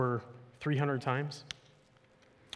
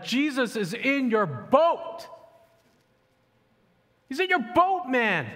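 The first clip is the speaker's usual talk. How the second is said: high, loud, slow exasperated shout rising higher; shouting at the disciples; 10 semitones higher, 4 dB louder, slower than usual. Retold or imitated. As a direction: pastor shouts at the disciples to wake up. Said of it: "you just wanna shout to them".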